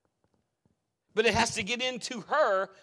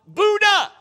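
"Buddha!" cried out loud and much higher; called out; 13 semitones higher, 14 dB louder than usual. Retold or imitated. Preacher acting a startled cry for help, loud call. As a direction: yell the name as if in danger. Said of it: "I could have called out".

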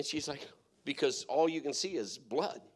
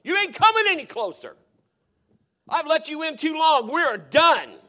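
much higher and louder, a fierce command; bold, loud rebuke aimed at the enemy; rebuking the devil; 13 semitones higher, 14 dB louder than usual. Acted. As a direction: shout him down, no more ground given. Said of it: "Sometimes you gotta tell the devil".

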